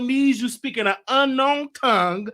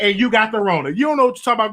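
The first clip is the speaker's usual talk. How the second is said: usual speaking voice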